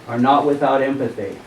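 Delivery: ordinary talk, nothing marked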